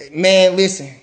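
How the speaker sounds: ordinary delivery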